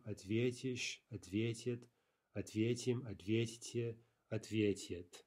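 ответишь, ответит, ответим, ответите, all stressed on ве; teacher's drill of ответишь to ответит, stem syllable ве stressed. Shown as a demonstration. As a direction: recite each form separately, stress staying on ве. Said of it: "Note the stress".

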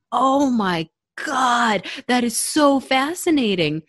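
amazed. Retold or imitated. Retelling her own amazed reply to the mentor teacher. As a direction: marvel at it slowly. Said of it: "And I was like".

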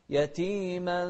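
usual speaking voice

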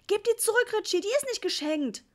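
high, loud frantic mum; panicked scolding yell; desperate shout. A narrator's reading. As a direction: shriek it at the child in despair. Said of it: "schrie Mama verzweifelt".

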